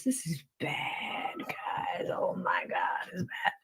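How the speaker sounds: hushed, breathy whisper of alarm. Real, not acted. Streamer whispering to chat that it's bad. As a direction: drop to a whisper as if the news is too bad to say aloud. Reading alarming court transcript.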